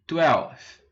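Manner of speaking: twelfth, teacher demo; model pronunciation. Shown as a demonstration. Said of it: "O décimo segundo".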